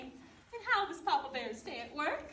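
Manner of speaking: usual speaking voice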